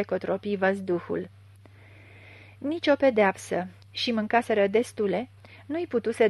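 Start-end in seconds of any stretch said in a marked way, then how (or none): none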